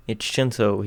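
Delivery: ordinary delivery, nothing marked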